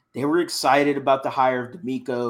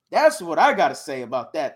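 usual speaking voice

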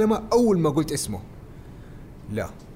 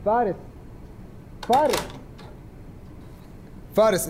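calling out; 7 semitones higher, 3 dB quieter than usual. Acted. Calling a dazed friend.

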